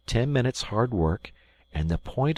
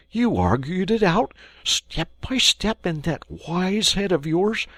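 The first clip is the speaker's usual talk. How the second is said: higher, louder admiring voice; awed Mole; 13 semitones higher, 6 dB louder than usual. Acted. Mole praising Rat's cleverness, awed. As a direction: gush with praise.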